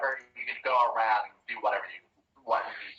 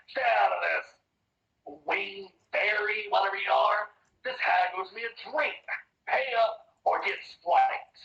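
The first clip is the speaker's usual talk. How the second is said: somewhat higher, threatening bear warning; lightly voiced gruff bear; 8 semitones higher than usual. Bear tells the fairy to butt out, mild menace.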